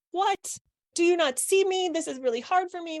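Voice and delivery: high-pitched hurt outburst, mock-indignant; triggered indignation. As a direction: throw it back like a stung kid who feels unseen. Acting triggered back at adult.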